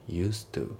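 "used to" with the D dropped. Shown as a demonstration. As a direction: run "used to" together as "use-tu", no D sound. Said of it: "ユーストのDが抜けますね".

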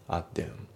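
"アッデン" with no T, like "a-them"; dropped T. Shown as a demonstration. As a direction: stop briefly, then go straight to TH in "アッデン". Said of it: "アットのTが抜けますね".

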